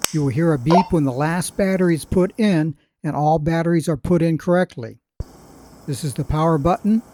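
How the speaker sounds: usual speaking voice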